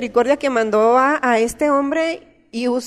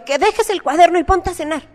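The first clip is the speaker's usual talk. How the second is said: put-on raised, louder scolding of a child at dinner; mother scolding her son for writing during dinner, loud and higher; 6 semitones higher, 4 dB louder than usual. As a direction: snap at the boy to put the notebook away and eat. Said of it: "Y así ya acá regañándolo".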